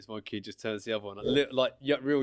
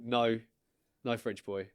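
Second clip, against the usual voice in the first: ordinary delivery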